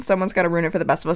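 ordinary delivery

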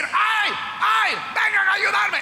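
un ay agudo y fuerte, pidiendo ayuda a gritos; grito de auxilio. Acted. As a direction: grita como pidiendo socorro fingido. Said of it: "ella comenzó a gritar". (la señora grita fingiendo que la atacan)

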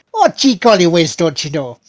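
hammed-up Midwestern American accent; bad American accent. Shown as a demonstration. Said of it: "if Will tried an American accent".

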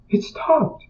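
high, soft voice of wonder; light disbelief as the weight lifts; amazed woman. Retold or imitated. Woman amazed trauma gone.

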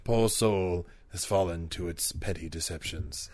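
hushed nature show voice; nature documentary. Pretend. Continues wildlife narration.

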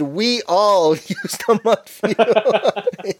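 drawn out, breaking into laughter; slow mock protest dissolving into laughs; mock group chorus. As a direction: imitate a crowd chanting slowly, laughing through it. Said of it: "in unison".

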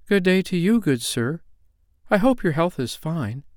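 put-on high, polite child's greeting; brave little girl. A narrator's reading. Lame girl answers the strange little man, bravely polite. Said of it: "she answered bravely".

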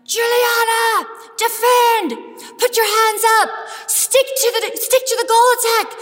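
proud mom yelling at her netball player; sideline yelling. Retold or imitated. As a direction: shout orders at your daughter from the bench. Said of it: "and I'm yelling".

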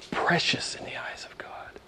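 breath-only, hushed repeat; reverent whisper. Real, not acted. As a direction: whisper it in awe. Awed repeat of the verse.

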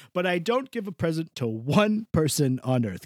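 usual speaking voice

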